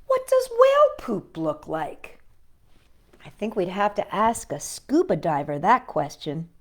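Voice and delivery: lively read-aloud voice for little listeners; reading to children. A narrator's reading. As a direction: read it like a bedtime picture book. Reading picture book to children.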